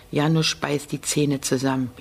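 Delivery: ordinary delivery, nothing marked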